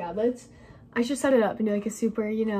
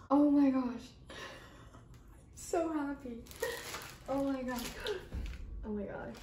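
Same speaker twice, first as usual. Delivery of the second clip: softer, breathless admiration; hushed awe; 8 dB quieter than usual. Real, not acted. Vlogger gazing at her new camera, hushed awe. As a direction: murmur it like a treasure.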